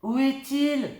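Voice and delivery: high eager question; peering up the tree; curious girl. A narrator's reading. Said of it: "demande Lulu Grenadine". (fillette curieuse, voix aiguë)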